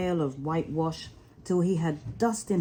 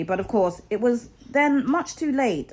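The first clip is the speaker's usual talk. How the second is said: plain reading voice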